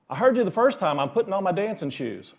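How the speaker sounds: the centipede, mildly fed up, calls out from its box; little centipede voice. Retold or imitated. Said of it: "a little voice from inside that says".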